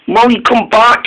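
high-pitched call after a friend; lightly voiced engine calling after a friend. Dennis still following Molly.

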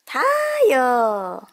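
long, loud, drawn-out "타요"; name call. Acted. Host announcing the Tayo bus, sung-out name. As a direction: sing out "타요" like a show title.

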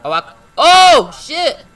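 startled frightened yelp; scared horror game streamer. Real, not acted. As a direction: jump out of your skin.

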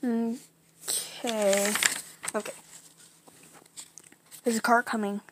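ordinary delivery

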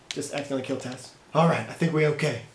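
usual speaking voice

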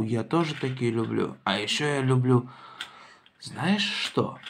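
ordinary talk

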